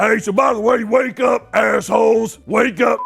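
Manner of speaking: loud, high hammed-up wake-up yell; mock bro shouting. Host mimics a contestant waking the sleeping girls, mock bro shout. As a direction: holler it as if rousing a whole house. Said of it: "like one of the bros".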